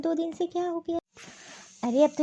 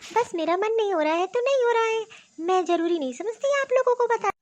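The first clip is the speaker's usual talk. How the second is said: defiant; 7 semitones higher than usual. Acted. Poonam defiantly refuses to cook.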